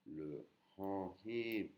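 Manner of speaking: ordinary talk, nothing marked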